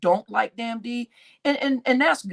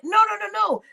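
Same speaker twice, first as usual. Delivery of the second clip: much higher, quick run of no's in alarm; urgent protest; 11 semitones higher, much faster than usual. Retold or imitated. Warning the channel owner about Regina, high and alarmed. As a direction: stop him with a high, urgent string of no's. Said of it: "And I'm like".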